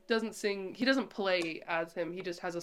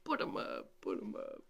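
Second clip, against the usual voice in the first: breathy, rasping cartoon lion; hammed-up scaredy lion bluster; Cowardly Lion impression; 13 semitones lower, 7 dB quieter than usual. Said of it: "Here's what he would say".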